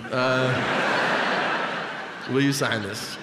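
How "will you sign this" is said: usual speaking voice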